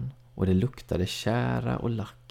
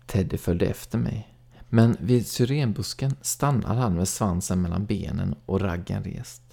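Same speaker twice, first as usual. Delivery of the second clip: ordinary delivery, nothing marked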